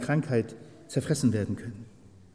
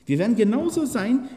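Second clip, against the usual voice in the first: genauso lifted high and held; emphatic genauso; stress on genauso; 10 semitones higher, 4 dB louder than usual. Real, not acted. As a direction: lean hard on genauso, pitched up. Preacher emphasizes.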